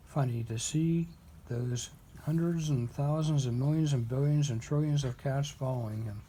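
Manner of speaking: ordinary delivery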